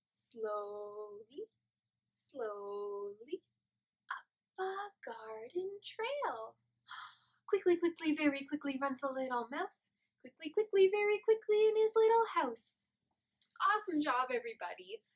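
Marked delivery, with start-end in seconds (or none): 0.1-6.5 very soft and slow, creeping like a snail; hushed slow singsong; gentle creeping whisper-song; slow soft snail verse. Shown as a demonstration. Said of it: "let's do that once more". 7.5-9.9 quick mouse verse. Shown as a demonstration. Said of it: "let's do that once more". 10.2-12.9 louder and higher, scurrying quick; bright quick mouse line building up; playful rush into the tickle; lively mouse verse. Shown as a demonstration. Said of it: "let's do that once more".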